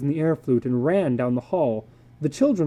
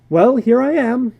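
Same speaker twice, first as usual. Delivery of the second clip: cheerful visitor; 9 semitones higher, 8 dB louder than usual. Acted. McLean arriving cheerfully.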